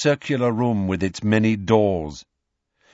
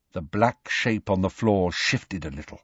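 usual speaking voice

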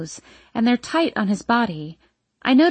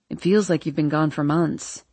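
Landon; 5 semitones lower than usual. A narrator's reading. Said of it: "he says".

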